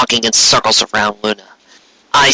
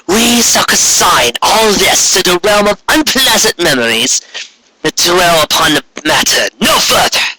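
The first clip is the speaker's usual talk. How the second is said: higher, slow, imperious command; Corona commanding; 8 semitones higher than usual. Acted. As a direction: demand obedience from a sister. Corona orders Luna to kneel.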